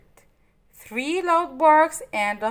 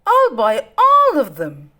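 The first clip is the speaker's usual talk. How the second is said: greedy demand; 3 semitones higher, 9 dB louder than usual. Acted. Cruella demands to buy puppies.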